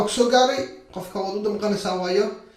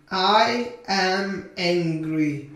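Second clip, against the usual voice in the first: very slow, drawn-out words; slow modelling; much slower than usual. Shown as a demonstration. Teacher models a sentence for English learners, very slowly. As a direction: stretch each word for learners.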